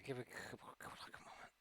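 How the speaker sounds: whispered aside. Real, not acted. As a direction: whisper it to yourself. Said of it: "I'm actually trying to do something here".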